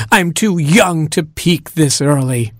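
high-pitched worried wail; distressed cry. A narrator's reading. Beauty beside herself over her career, high distressed cry. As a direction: lament it, high and panicky. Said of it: "she cried".

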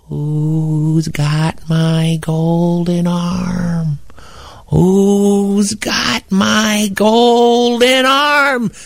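very slow, drawn-out eerie wail; spooky ghost moan. Shown as a demonstration. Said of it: "It goes like this".

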